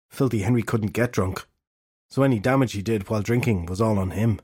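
plain reading voice

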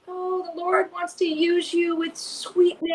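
soft, slow, sugary hedging; evasive sugary dodge. Retold or imitated. Preacher dodging a strange word, softly sugary and slow. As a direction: sweeten it to dodge the real word. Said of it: "trying to dance around this".

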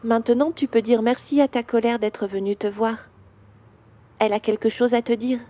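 calm questions with long pauses, as a gentle father; soothing guided visualisation. Acted. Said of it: "Je vais te parler comme me parle mon papa".

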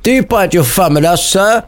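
high theatrical challenge, about to crack up; mock shouting. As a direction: pitch SIR right up in mock outrage.

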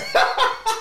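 loud, high-pitched laugh; burst of laughter. Real, not acted. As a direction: crack up loudly. Cracks up at being told off.

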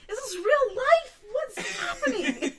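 high, loud laughing disbelief. Retold or imitated. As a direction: crack up in disbelief. Retold disbelief after fall, laughing.

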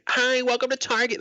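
super happy greeting. Retold or imitated. Young cart cleaner greeting shoppers, super happy. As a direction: chirp it like an eager greeter. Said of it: "Like, super happy".

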